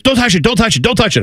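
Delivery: put-on high, panicky child's plea; terrified child. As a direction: imitate a scared little kid pleading. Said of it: "she is more terrified".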